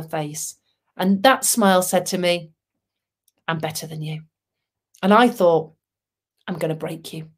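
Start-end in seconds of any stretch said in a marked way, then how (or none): 3.5-4.2 low, soft, deadpan boast; understated smugness. Retold or imitated. Voicing a smug smile, silently said. 6.5-7.3 low, soft private threat; mock menace. Voicing a private menacing thought.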